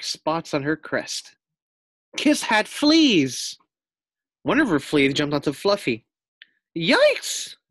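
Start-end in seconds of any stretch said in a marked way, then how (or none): 2.2-3.6 high, slow and drawn out; dramatic reveal. A narrator's reading. Narrator to the class reveals fleas, slow and high. 6.8-7.6 high, yelped yikes; startled. Acted. Pig sees a flea jump onto him, startled yelp. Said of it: "thought Fluffy".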